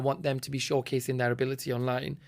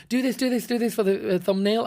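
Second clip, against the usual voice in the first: higher-pitched imitation of a pushy mum; imitating a pushy mother; 6 semitones higher than usual. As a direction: urge the child on, as a retold mum. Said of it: "she's saying to a kid".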